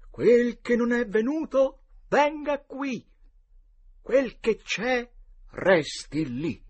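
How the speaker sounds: slow, high, loud incantation; solenne formula magica. A narrator's reading. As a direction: recite it solemnly like a spell. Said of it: "recitò solennemente questa formula magica". (formula magica recitata solennemente)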